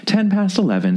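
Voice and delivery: put-on high, singsong old lady voice; a vague, creepy old lady; old woman. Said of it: "said the old lady".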